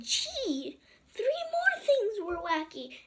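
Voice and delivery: high, loud and slow, amazed; excited exclamation. Acted. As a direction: sound amazed at the wacky things. Boy's exclamation in story.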